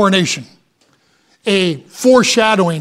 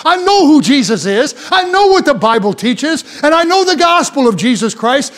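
raised, driving sermon delivery; forceful preaching; 4 semitones higher than usual. Real, not acted. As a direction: preach it with rising fervour, rolling from phrase to phrase.

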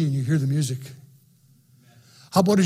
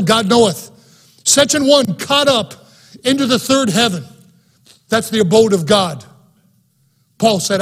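higher, louder, ringing pulpit preaching; preaching at full force; 4 semitones higher, 5 dB louder than usual. Acted. Pastor urging his radio congregation about heaven, loud fervent preaching. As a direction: drive it home to the congregation.

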